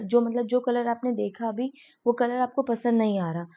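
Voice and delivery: ordinary talk, nothing marked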